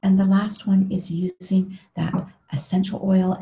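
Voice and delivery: normal talking voice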